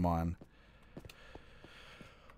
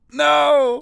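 loud high exasperation; frustrated; 13 semitones higher, 9 dB louder than usual. Real, not acted. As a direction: yell at the stupid stairs. Fails jumps repeatedly.

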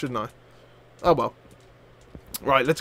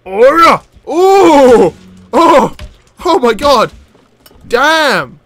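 loud, raised bursts of delight; amazed exclamations; 5 semitones higher, 9 dB louder, much slower than usual. Real, not acted. Gamer thrilled by weapons found over here, amazed exclamations. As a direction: exclaim louder with each astonished oh. Amazed by the oar's power in play.

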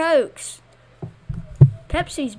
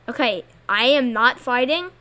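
ordinary talk, nothing marked

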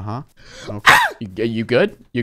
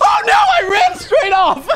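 shouted dismay; 4 dB louder than usual. Real, not acted. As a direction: shriek in comic dismay as you fall. Player falls off the map.

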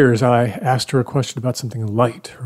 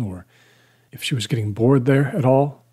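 plain reading voice

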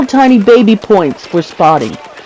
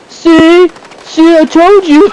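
high, loud mock gloating; hammed-up I-told-you-so; imitated credit-taking; 9 semitones higher, 4 dB louder, slower than usual. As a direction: crow as if you won it. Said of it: "they take credit for it".

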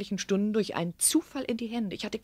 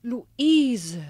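louder exclamation of the name; surprised outcry; 2 semitones higher, 6 dB louder than usual. Acted. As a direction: call the name out in shock. Mother discovers daughter's identity. (überraschter Ausruf)

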